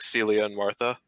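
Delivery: ordinary talk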